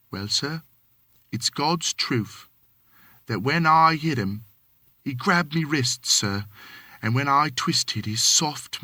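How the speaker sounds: lightly voiced Cockney manservant, deferential; a hint of London Cockney, polite to his master; Cockney servant. Thomas answering his employer, light Cockney.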